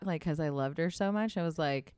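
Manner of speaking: ordinary delivery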